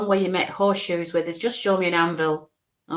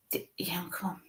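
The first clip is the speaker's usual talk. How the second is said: whispered coaxing; 14 dB quieter than usual. Real, not acted. Medium whispering to spirit.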